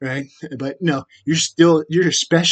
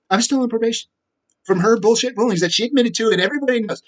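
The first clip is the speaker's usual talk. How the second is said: higher, indignant restatement; outraged complaint; 7 semitones higher, faster than usual. Real, not acted. Ranting to Lisa about probation, outraged. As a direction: let the outrage lift the voice.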